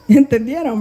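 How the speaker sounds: real chuckle before the question; laughing. Real, not acted. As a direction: ask it while still chuckling. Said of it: "¿Por qué me río?".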